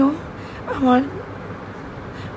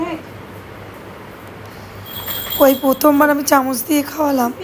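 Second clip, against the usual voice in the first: ordinary talk, nothing marked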